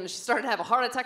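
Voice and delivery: ordinary talk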